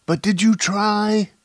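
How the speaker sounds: very high, loud, desperate question; frantic. A narrator's reading. Woman demands whether he even tried, frantic. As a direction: insist frantically, pitch soaring. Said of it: "she asked frantically".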